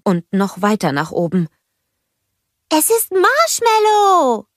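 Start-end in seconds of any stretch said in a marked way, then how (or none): 2.7-4.5 high, slow, delighted snowman voice; joyful cry. A narrator's reading. Olaf spots his friend, full of delight. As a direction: beam out the name with pure joy. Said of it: "rief er voll Freude".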